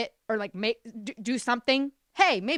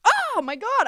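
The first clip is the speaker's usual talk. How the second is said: ordinary talk, nothing marked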